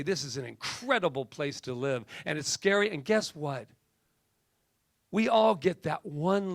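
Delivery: impassioned, driving sermon delivery; preacher. Real, not acted. Preacher urging listeners on what to leave children, impassioned preaching. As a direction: preach it with hammered key words and rising force. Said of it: "It's the preacher in me".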